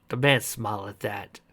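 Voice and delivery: usual speaking voice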